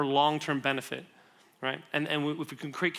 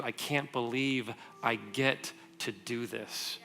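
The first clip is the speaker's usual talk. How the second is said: slow, soft and low awe; hushed, unhurried gratitude, lingering on each word; grateful wonder; 4 semitones lower, 5 dB quieter, much slower than usual. Acted. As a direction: savor it slowly, quietly amazed. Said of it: "we shift from".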